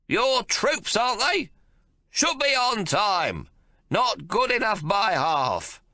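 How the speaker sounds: put-on high, loud, slow cranky old man complaining; cantankerous old father fuming; irascible old man. A narrator's reading. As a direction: grumble like a furious old codger. Old man figure held.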